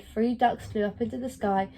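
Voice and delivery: ordinary delivery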